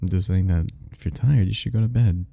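normal talking voice

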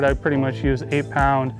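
normal talking voice

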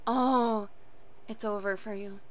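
low, soft remark; subdued aside. Real, not acted. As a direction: drop low and quiet. Reacting after the cheer.

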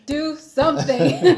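laughing mock demand; mock command. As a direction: bark it out half-laughing. Mocking producers, laughing.

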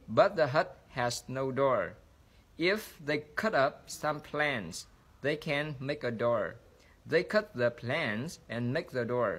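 slow, careful sentence-by-sentence reading; slow model reading. Shown as a demonstration. Teacher slows down so students can follow easily, slow model reading. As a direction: read slowly with clear pauses between phrases. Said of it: "tôi sẽ đọc chậm lại".

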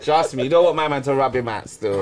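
normal talking voice

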